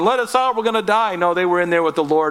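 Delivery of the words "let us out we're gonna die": mock panic. Preacher imitating men pounding on the furnace door, high mock panic. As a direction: ham up the terrified prisoners begging. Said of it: "pounding on the door going".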